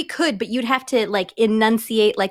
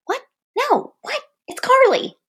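high voice; 14 semitones higher than usual. Pretend. Pretend voice of God scene.

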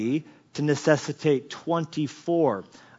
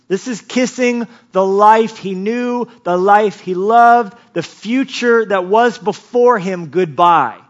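loud, high sermon delivery; impassioned preaching; 6 semitones higher, 8 dB louder than usual. Real, not acted. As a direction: preach it at full force, raised in pitch. Preaches at full force.

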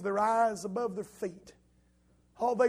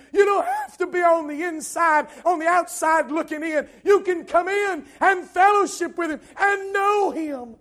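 loud, high, urgent preacher's voice; full-force preaching; 8 semitones higher, 11 dB louder than usual. Real, not acted. Preacher urging those outside to come in, full-force preaching. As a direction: drive the invitation home at a shout.

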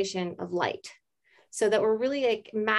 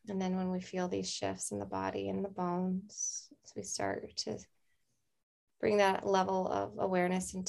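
guided meditation. Acted. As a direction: ease them into calm breath. Leads a breathing meditation.

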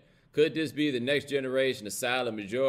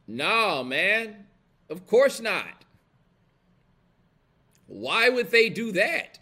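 rising higher and louder, mock disbelief; sarcastic scoff; 8 semitones higher, 5 dB louder, slower than usual. Real, not acted. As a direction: scoff like the answer is obvious. Scoffing at schools hiding history.

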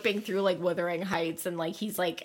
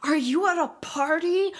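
high-pitched mock freak-out; whiny, accusing boyfriend, pitched way up; Noah; 8 semitones higher than usual. Boyfriend texting her about the party, high mock freak-out. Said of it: "seventeen-year-old boy is freaking out that she's at a party".

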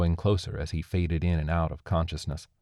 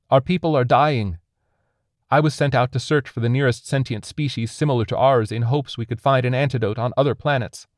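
high, raised, impassioned voice; pleading alien; 7 semitones higher, 5 dB louder than usual. A narrator's reading. Alien begs captor to let it die, impassioned pleading. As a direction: plead desperately for a dying people.

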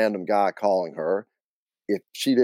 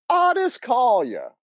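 slow, high-pitched exclamation; gleeful Southern disbelief; incredulous aunt; slower than usual. Acted. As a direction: draw the name out high in disbelief. Acted aunt repeating name in disbelief.

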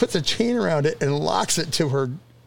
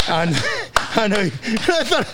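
ordinary delivery

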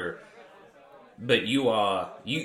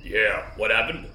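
voice filter; 3 semitones lower than usual. Acted. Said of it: "now you've put on some other filter thing".

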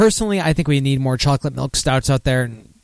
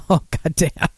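ordinary talk, nothing marked

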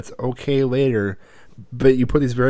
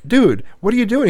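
shocked parents; 9 semitones higher, 3 dB louder than usual. Retold or imitated. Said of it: "Jody and I are like".

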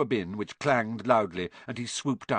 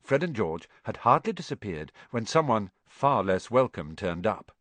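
ordinary delivery

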